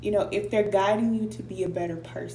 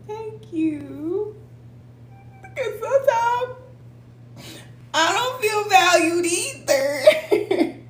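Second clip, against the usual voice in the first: higher, slow words breaking into a laugh; laughing through tears; 12 semitones higher, 4 dB louder, slower than usual. Real, not acted. Live host thanking viewer, laughing through tears. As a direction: laugh while the feeling cracks through. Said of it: "Look, I'm laughing".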